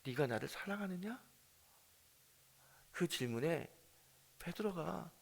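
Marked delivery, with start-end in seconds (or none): 0.1-1.2 gentle question. Acted. Jesus's question repeated.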